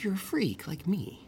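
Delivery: high, slow villain imitation; slow, taunting mock villain, pitched high; Joker. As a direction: put on a high, drawn-out villain voice. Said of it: "when he's talking to Batman".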